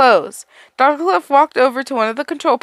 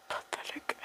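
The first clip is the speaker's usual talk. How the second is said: whisper; 23 dB quieter than usual. Acted. Self-contempt whispered aside.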